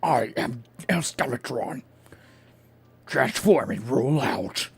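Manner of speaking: slow, deliberate robot-villain announcement; mock Transformer villain declaration; Decepticon voice. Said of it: "sounds like a fucking Decepticon's name".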